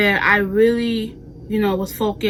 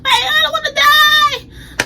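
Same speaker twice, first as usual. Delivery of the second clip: high, loud reenacted scream; screaming in panic; 6 dB louder than usual. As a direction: wail it in mock terror. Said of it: "I started screaming".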